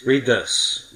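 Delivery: normal talking voice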